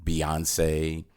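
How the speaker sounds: usual speaking voice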